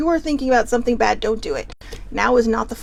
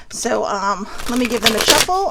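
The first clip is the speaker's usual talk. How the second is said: normal talking voice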